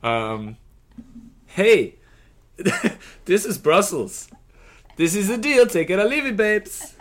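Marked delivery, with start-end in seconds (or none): 1.5-6.9 high, loud hammed-up Dutch accent. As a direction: play it as a Dutch Eurovision host. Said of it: "Or maybe in a Dutch accent".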